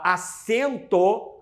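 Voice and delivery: acento stretched out slowly, rising at the end; drawn-out key word. Shown as a demonstration. Teacher to students, slowly stretching out acento. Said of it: "quando a gente fala".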